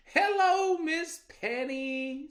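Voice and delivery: high, loud sing-song hello; butter her up; sly sweet greeting. Acted. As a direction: trickster rabbit sweet-talking the girl. Rabbit greets again.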